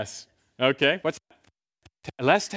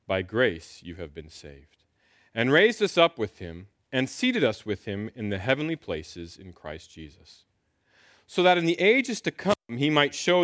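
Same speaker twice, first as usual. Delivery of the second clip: scripture reading; 4 semitones lower than usual. Acted. Pastor reading Ephesians to the church, measured and solemn. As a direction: read the passage to the congregation with gravity. Reads Ephesians two aloud.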